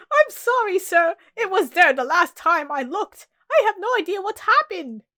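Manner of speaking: loud, high frantic pleading; panicked apology. Acted. Cook apologising to the furious Queen, panicked.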